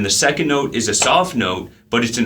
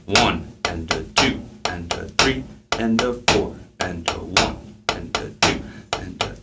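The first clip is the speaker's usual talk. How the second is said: quiet, measured counting with spaces between beats; 4 semitones lower, 5 dB quieter than usual. Shown as a demonstration. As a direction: count softly and low, evenly in time. Counting aloud while drumming the exercise slowly.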